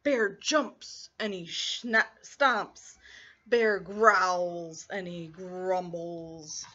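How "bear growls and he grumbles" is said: slow, low growly narration. A narrator's reading. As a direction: grumble it like a bear. Bear grumbles.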